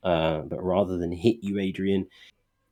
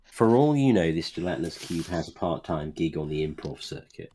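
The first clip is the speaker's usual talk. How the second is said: normal talking voice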